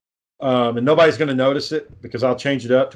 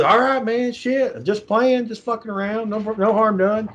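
high, nervous backing-down voice; a scared hillbilly laughing it off, pitched way up; 8 semitones higher, slower than usual. Retold or imitated. Hillbilly with a gun at his head, high and nervous.

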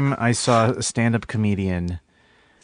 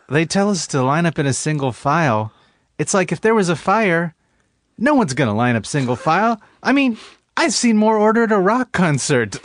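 put-on kid comedian; 6 semitones higher than usual. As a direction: deliver it like a nervous teen comic. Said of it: "there's one joke".

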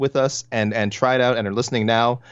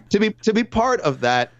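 mock emotional; 11 semitones higher than usual. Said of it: "I'm so emotional".

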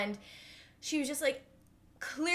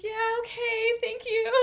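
awkward reply; 11 semitones higher, 4 dB louder than usual. Retold or imitated. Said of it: "And I was just like".

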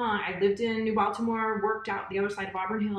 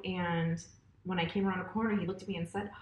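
usual speaking voice

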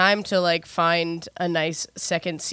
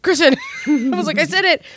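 laughing; 9 semitones higher, 5 dB louder, faster than usual. Real, not acted. Host laughing at herself.

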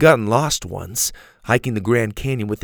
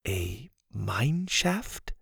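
puzzled repeat; 8 dB quieter, much slower than usual. A narrator's reading. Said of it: "the girl repeated".

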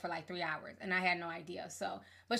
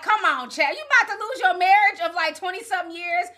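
loud exasperated scolding; 8 semitones higher, 8 dB louder than usual. Retold or imitated. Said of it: "But I'm like".

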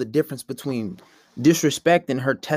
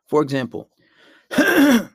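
normal talking voice

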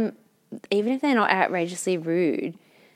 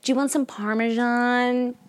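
slow, higher mock server offer; server imitation; 4 semitones higher, slower than usual. Server offering pasta diner cheese, drawn-out imitation. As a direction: stretch it out like a server's spiel. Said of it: "just so they'll say it to me".

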